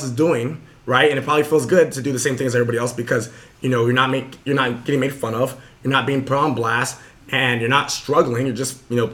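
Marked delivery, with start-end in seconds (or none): none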